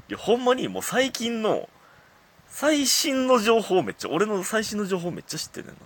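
amazed. Real, not acted. Amazed at a friend knowing everything.

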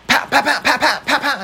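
high, laughing pan pan hit noises; mouth punch sounds. Retold or imitated. Boxer keeps voicing fake hits, high and laughing. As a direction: keep popping out fake impact sounds. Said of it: "って言い続けて".

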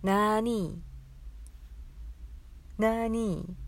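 「なーに？」の「な」を伸ばし、語尾を上げて問いかける; 名古屋弁らしく「なーに？」と軽く伸ばして聞く; 名古屋弁の「なーに？」. Shown as a demonstration. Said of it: "こちらです". (「なーに？」を伸ばして語尾上げ)

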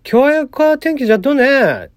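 slow, lilting Kagoshima-dialect demo; a hint of Kagoshima dialect. Shown as a demonstration. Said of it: "これ鹿児島弁に直すと、".

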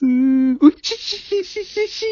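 sneaky giggle. Real, not acted. Gleeful prank plotting giggle.

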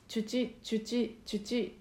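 「チュチ」 repeated softly, with a clear ch closure; chuchi drill. Shown as a demonstration. Voice teacher drilling a closed-consonant exercise, chuchi. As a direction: drill the syllables チュチ crisply. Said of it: "シーエイチに変えて何度か言ってみる".

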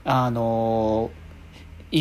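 usual speaking voice

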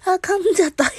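laughing at a flub. Real, not acted.

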